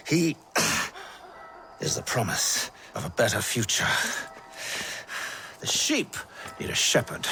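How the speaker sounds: breathless dying whisper; barely a breath left, let it out; a dying man whispering his creed. Acted. Dying man's last words.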